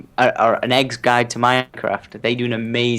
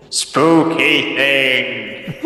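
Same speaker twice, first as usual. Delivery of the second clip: very drawn-out spooky voice with reverb; much slower than usual. Shown as a demonstration. Guest showing off a requested spooky voice on Skype, spooky. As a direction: stretch each word out eerily, like a ghost. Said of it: "Can I get a spooky voice".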